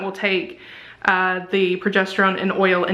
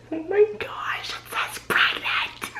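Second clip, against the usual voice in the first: breathless whisper, slow on pregnant; stunned whisper; 12 semitones higher, 5 dB quieter, slower than usual. Real, not acted. Reading the pregnancy result aloud, a stunned whisper. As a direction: barely voice it in shock.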